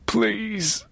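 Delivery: high, pitiful whimper; little whimper. A narrator's reading. Priest begging his captor, pitiful whimper. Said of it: "in a terrible little whimper".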